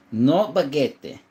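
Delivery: slow, hammed-up French accent; a slow jokey fake French "No baguette"; mock French accent. Joking about having no French guns.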